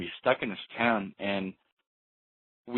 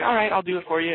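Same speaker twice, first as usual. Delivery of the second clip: high, loud imitation of Dave giving in; mock Dave agreeing; 10 semitones higher, 6 dB louder, faster than usual. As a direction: toss it off high as the eager oddball.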